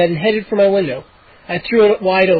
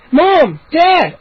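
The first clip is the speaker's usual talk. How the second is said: much higher, loud cry; urgent calling out; 10 semitones higher, 5 dB louder than usual. A narrator's reading. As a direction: call them from the hall. Boy wakes parents urgently.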